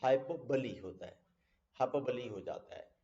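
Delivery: hyperbole as hy-puh-buh-LEE; correct pronunciation. Shown as a demonstration. Teacher repeating the correction, hyperbole ending in "buh-lee". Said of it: "this is".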